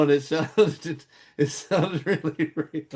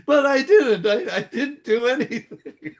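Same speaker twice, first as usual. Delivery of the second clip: pitched-up squeal of denial; mortified protest; 7 semitones higher than usual. Retold or imitated. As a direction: plead innocence, embarrassed and flustered. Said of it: "he goes".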